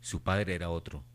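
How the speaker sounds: usual speaking voice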